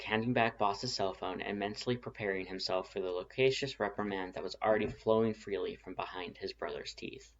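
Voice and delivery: ordinary delivery, nothing marked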